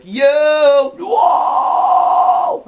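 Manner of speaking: loud, high, raspy sustained scream; metal scream. Shown as a demonstration. Vocalist showing viewers his stage scream, screamed loud and raspy. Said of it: "when I go to scream".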